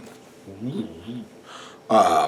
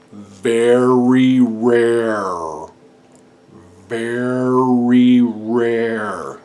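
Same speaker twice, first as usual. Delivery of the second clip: very slow, stretched very rare; drawn-out emphasis; 6 dB louder, much slower than usual. Shown as a demonstration. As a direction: stretch every sound of very rare.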